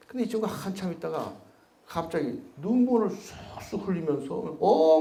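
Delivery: ordinary delivery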